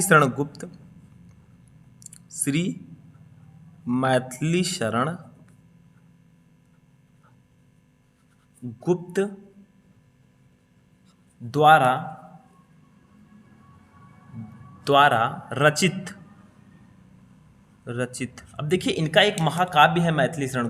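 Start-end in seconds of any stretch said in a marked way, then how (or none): 2.3-9.4 Shri, Maithili Sharan, Gupta with long gaps; halting write-along pace, each part left hanging; slow dictation. Shown as a demonstration. Dictating while writing it down. 11.4-18.4 words spaced by long pauses; piece-by-piece write-along delivery; slow dictation. Shown as a demonstration. Dictating while writing it down.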